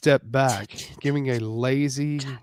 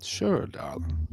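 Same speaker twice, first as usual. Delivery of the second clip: much lower, softer, suggestive; low male voice; 7 semitones lower, 3 dB quieter than usual. Acted.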